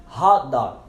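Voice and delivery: "hot dog" with the T of hot held back; silent final T. Shown as a demonstration. Teacher models "hot dog" with silent T. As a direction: cut "hot" short without releasing T, then "dog". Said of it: "silenciamos o T quando a palavra termina".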